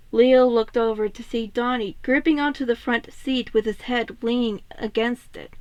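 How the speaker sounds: plain reading voice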